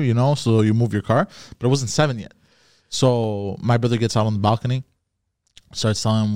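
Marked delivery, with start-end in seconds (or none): none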